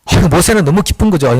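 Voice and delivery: ordinary talk, nothing marked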